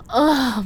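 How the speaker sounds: short sharp moan; sudden moan. Acted. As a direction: let out a quick gasp. Narrating sex, gasps.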